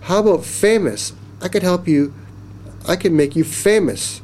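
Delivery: high, eager pitch to the giant; mild shout. A narrator's reading. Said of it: "Jackie shouted".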